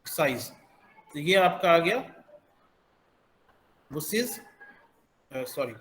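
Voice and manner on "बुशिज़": बुशेस ending in a voiced "iz". Shown as a demonstration. Teacher demonstrating the plural ending to learners, "iz" pronunciation. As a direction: say the end of बुशेस like "iz". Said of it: "ईज की तरह pronounce करेंगे".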